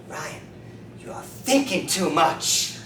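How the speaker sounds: very slow, drawn-out verdict; acted drugged pronouncement. Dozing heroin user advises his chiropractor, slow drugged pronouncement. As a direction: stretch each word out, as if half nodding off.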